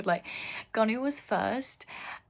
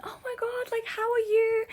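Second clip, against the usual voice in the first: much higher, near-crying gush; overexcited squeal; 13 semitones higher than usual. Retold or imitated. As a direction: gush at him like a starstruck fan. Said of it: "I literally went".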